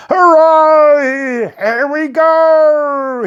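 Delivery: put-on high, loud old man's cheer; enthusiastic shout. A narrator's reading. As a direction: cheer like a freed old man. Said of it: "he shouted most enthusiastically".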